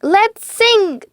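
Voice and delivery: high, loud furious command; Anger orders them to sing, loud and furious; angry shout. A narrator's reading. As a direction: bark it like Anger losing patience. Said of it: "shouted Anger".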